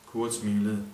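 plain reading voice

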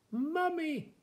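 high-pitched storybook cry; calling out. A narrator's reading. Cat and teddy shouting for a mummy, calling out high. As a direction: call it high, like small animals crying out. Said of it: "shouted the cat and the teddy".

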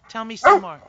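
loud sing-song coaxing; loud coaxing call. Real, not acted. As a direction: urge the pet on from a distance. Coaxing the pet.

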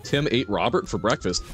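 ordinary talk

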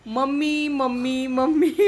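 mother imitating the parrot calling mummy. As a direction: call mummy like the parrot squawking. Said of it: "इतका ओरडतो".

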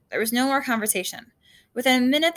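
ordinary delivery, nothing marked

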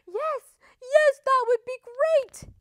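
very high, loud, moved reply; tearful acceptance. A narrator's reading. Sniffing wolf accepting tea from Missus Duck, tearfully overjoyed. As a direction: accept eagerly through sniffles. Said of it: "he sniffed".

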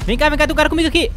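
fast, high, urgent call; excited shouting. Real, not acted. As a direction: hurry them over, loud. Urgent calling to teammates.

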